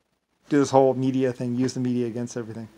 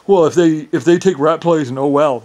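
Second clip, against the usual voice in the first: imitating Rodney; 3 semitones higher, 11 dB louder than usual. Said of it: "like Rodney said".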